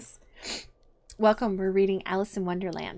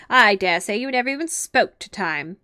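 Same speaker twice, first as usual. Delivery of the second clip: louder, scoffing lecture; scornful Hatter; 8 dB louder than usual. Acted. Hatter scoffing at Alice, loud and scornful. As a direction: talk down to her loudly.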